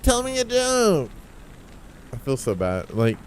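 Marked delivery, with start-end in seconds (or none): 0.0-1.1 very high, slow, put-on voice; high mock demand. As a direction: squeak the request in a silly high voice. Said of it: "and they're like".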